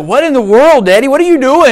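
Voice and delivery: mock alarmed son; child's alarm. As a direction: imitate a startled kid. Son's alarmed quote.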